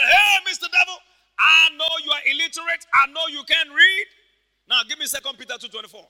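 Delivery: high, loud call to the devil; acted taunting shout. Papa Hagin calling out an illiterate devil, taunting shout. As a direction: holler down at a foolish devil.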